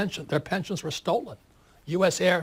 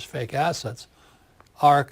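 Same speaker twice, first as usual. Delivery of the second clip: ordinary talk, nothing marked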